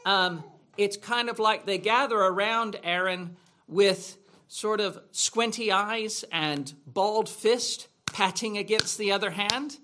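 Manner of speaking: usual speaking voice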